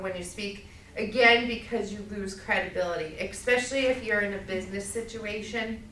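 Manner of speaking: ordinary delivery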